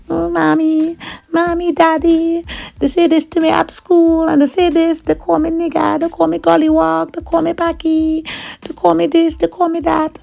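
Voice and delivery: put-on high, wailing child reeling off names; child sobbing the list to her parents; crying child. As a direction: whimper it like a hurt little girl. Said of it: "I came home crying".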